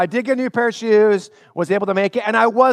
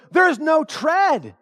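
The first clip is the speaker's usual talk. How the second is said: mom exclaiming; 10 semitones higher, much slower than usual. Retold or imitated. Mom exclaiming at worn shoes.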